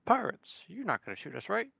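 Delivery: mock muttered aside, pitch jumping high; under-breath muttering. Arthur privately doubts the pirates won't shoot, hammed-up high muttering. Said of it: "Arthur's muttering all this under his breath".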